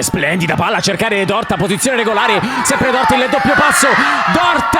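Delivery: rising excited commentator shout; excited commentary. Real, not acted. Goal chance, live commentary.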